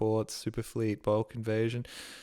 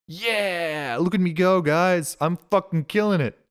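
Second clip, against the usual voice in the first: excited gloating; 6 semitones higher, 7 dB louder than usual. Real, not acted. Celebrating a good guess on stream.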